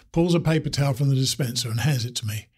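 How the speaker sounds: ordinary delivery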